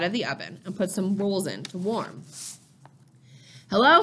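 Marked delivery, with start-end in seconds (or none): 3.7-4.0 loud, higher-pitched hello; calling out. A narrator's reading. Father calling from the front door, calling out. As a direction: call out a greeting through the house. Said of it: "Father called from the front door".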